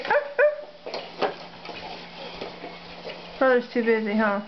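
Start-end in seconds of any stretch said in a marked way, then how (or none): 0.0-1.3 high whispered arf arf; soft puppy yap; dog bark. Shown as a demonstration.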